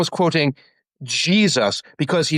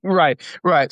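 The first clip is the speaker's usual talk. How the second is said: normal talking voice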